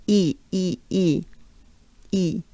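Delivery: "E" said through a wide smile; banana-smile E. Shown as a demonstration. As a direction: drill a spread-lipped "E", one at a time. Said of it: "we have a banana smile".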